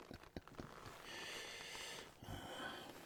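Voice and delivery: breathy quiet chuckle; a hushed little laugh; soft laugh. Acted. Vampire chuckling softly at his own joke.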